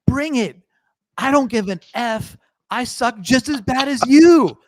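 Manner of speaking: hammed-up fighting yell, high and loud; [name]. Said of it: "You know, they're yelling".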